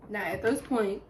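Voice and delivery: normal talking voice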